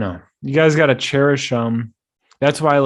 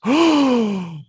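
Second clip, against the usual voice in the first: high, loud mock gasp of shock; 13 semitones higher, 5 dB louder than usual. Host imitating girlfriend shocked by news, gasping oh.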